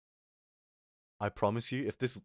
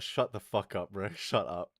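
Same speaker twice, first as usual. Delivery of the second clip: usual speaking voice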